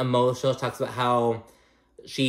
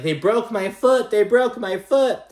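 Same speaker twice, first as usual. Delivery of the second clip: much higher, louder whiny cry; mock whiny wail; 10 semitones higher, 5 dB louder than usual. Adriana wailing over her hurt foot, mock whiny. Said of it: "She was like".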